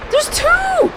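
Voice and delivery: high alarmed cry. Real, not acted. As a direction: blurt it out in alarm. Alarmed at two enemies.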